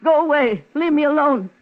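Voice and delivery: woman screams at him to leave her alone; angry outburst. Acted. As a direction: drive him out with a raw shout. Woman driving off killer ex.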